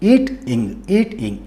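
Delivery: ఈట్ ఇంగ్ split into two beats; syllable by syllable. Shown as a demonstration. Teacher breaking a word for learners, syllable by syllable. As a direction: say ఈట్, brief gap, then ఇంగ్. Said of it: "ఇక్కడ ఒక one syllable".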